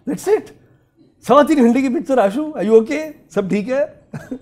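high, loud mock shock; mock disbelief. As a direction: feign shock, teasing a friend. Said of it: "I said".

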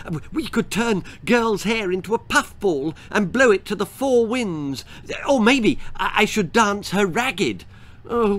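high giddy fairy voice; excited fairy. Acted. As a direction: gush with silly ideas, pitched high.

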